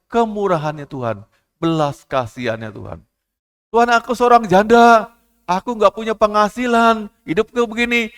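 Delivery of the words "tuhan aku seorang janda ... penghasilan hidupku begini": high, loud desperate cry to God; widow's plea. Acted. Widow with no income crying out to God, loud and high. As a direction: cry out your need, voice straining high. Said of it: "widow crying out to God".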